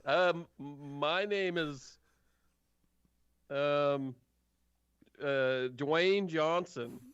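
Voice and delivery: slow, halting, drawn-out answer; hesitant guard. Acted. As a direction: stall while making up a name. Guard invents a name when asked.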